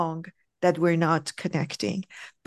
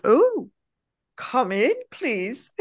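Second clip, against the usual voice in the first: gently sarcastic; 4 semitones higher, slower than usual. Retold or imitated. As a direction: imitate a man's sarcastic welcome without harshness. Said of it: "very beautifully sarcastic, but not, not harsh".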